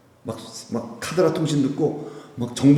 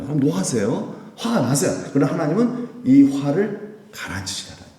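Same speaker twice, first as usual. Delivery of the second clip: usual speaking voice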